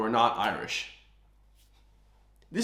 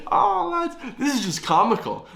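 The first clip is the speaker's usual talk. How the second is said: higher, louder words through laughter; laughing; 12 semitones higher, 4 dB louder than usual. Real, not acted. Vlogger telling his viewers it is comical, laughing. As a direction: laugh it out in disbelief.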